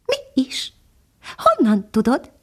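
a little hidden bird answering from the branches; tiny nightingale. Acted. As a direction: speak as a tiny, gentle songbird. Nightingale voiced in story.

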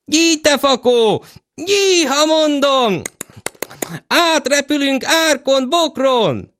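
high, slow horse-urging cry; loud drawn out herding calls. Acted. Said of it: "a hangja betöltötte a palotát".

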